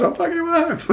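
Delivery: very high squeaky mock voice; comically eager agreement. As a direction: agree in a silly high pitch.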